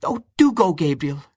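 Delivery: begging him gladly to go; bursting with excitement; excited cry. A narrator's reading. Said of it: "she cried".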